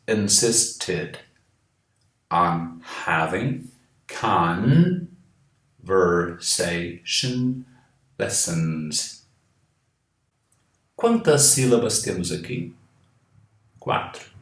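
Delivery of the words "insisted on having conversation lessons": slow on having conversation lessons, every syllable clear; slow careful model. Shown as a demonstration. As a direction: read insisted on having conversation lessons slowly like a teacher. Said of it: "Cuidado, aqui é um E".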